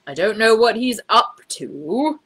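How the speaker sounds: slow, weary and resigned; gloomy. A narrator's reading. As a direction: sigh it out, fearing the worst. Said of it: "said Mister Brown gloomily".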